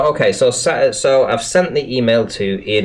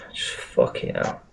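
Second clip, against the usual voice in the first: much lower and softer, voiceless; exhausted mutter; 10 dB quieter than usual. Real, not acted. Streamer to himself, drained after the email, exhausted mutter. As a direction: breathe it out under your breath.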